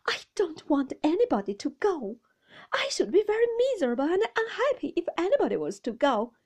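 high, loud, wounded protest; tearful pleading. A narrator's reading. Mother pleading with her husband through tears, high and upset. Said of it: "and with many tears".

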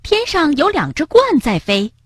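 high-pitched excited announcement; excited call. A narrator's reading. Rabbit tells Frog about two storks flying, excited. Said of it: "兴奋地喊道".

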